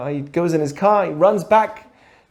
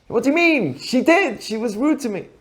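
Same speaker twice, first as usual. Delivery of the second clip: indignant wife; 5 semitones higher than usual. Acted. Wife objects to husband.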